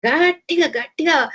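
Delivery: loud. Shown as a demonstration. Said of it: "గట్టిగా అరిచి worship చేయాలని".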